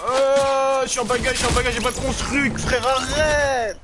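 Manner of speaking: high, loud frantic yell; panicked yelling. Real, not acted. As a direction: shout in panic at teammate. Gamer panicking under fire.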